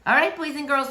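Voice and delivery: usual speaking voice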